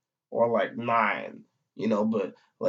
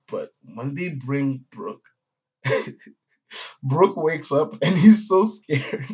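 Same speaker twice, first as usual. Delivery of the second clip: usual speaking voice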